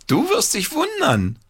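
put-on high gleeful boy's shout; excited call. A narrator's reading. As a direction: call out with a secret. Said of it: "rief Paul".

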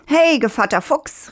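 high, rising call of a cocky rooster. Acted. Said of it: "rief der Hahn". (hoher, rufender Hahn)

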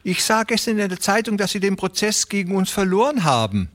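high, fawning voice, quick in the middle; fat Beermann greeting. A narrator's reading. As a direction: Beermann sucks up to the ranchers, high and fast. Beermann voice again.

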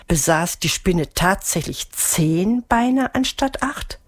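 usual speaking voice

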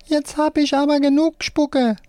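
very high-pitched puppet cry; left hand's cry. A narrator's reading. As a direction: cry out as a high puppet, without much volume. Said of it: "seine linke Hand schreit".

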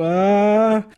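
startled high squeal; shrill cry. A narrator's reading. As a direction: psychiatrist lets out a sharp high shriek. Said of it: "entfährt ihm ein spitzer Schrei".

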